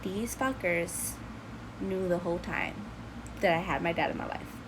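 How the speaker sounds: soft, retold stunned thought; inner thought. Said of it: "my mind was just like".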